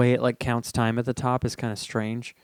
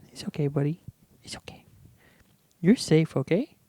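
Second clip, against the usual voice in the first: cooing to a scared pet; 3 semitones higher, 4 dB quieter than usual. Real, not acted. Soothing his scared dog.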